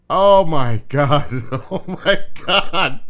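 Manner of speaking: slow laugh-drenched oh my God; horrified laughter. Real, not acted. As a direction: laugh through the horror. Laughing in horror at video.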